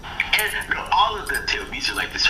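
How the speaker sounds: ordinary delivery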